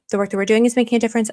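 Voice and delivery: fast, rattled off at speed; rattled-off repeat. Shown as a demonstration. Streamer restating her official answer, quickly. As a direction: zip through the line quickly. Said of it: "That's what I'll say".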